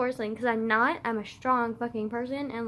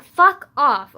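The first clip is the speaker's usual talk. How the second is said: angry; 12 dB louder than usual. Real, not acted. Telling him to back off.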